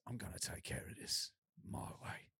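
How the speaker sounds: low, whispery action-hero imitation; Jason Statham tough guy. Mimicking the avenging film hero's vow, whispered tough-guy voice. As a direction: growl it under the breath, very low and quiet. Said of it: "this is when the accent goes".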